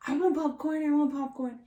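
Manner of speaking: put-on high, loud child's demand, repeated; mock crying tantrum. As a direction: imitate a kid wailing for a treat. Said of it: "crying on the floor and just being like".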